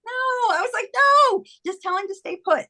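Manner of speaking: high-pitched, comic refusal; retold protest. Widow telling psychic he should stay put, in comic protest. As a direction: shoot the idea down in mock alarm.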